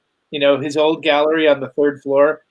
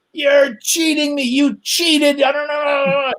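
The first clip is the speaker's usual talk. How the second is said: mimicked angry yelling; 11 semitones higher, slower than usual. Sore loser yelling at the coin flipper, high and angry. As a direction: yell it like a sore loser caught out. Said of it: "started yelling".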